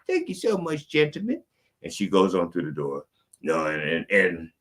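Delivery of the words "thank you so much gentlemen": put-on high falsetto old lady, politely grateful; a frail dainty lady thanking her escorts. Old lady thanking the gentlemen before going through the door, high falsetto. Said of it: "She says".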